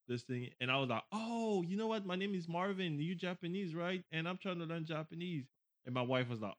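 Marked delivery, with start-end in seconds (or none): 1.1-5.5 high, softer retold eagerness; eager introduction. Japanese learner meeting his relative's guest, eager. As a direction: introduce yourself keenly in a raised voice.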